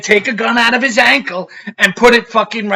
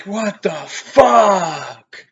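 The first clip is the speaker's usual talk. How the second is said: stunned disbelief; much slower than usual. Retold or imitated. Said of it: "I'm like".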